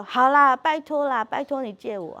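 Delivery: put-on loud, high begging kid voice; pleading classmate. The classmate begging the daughter to lend the eraser, in a loud high plea. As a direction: beg sweetly like a chastened child. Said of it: "那个同学就".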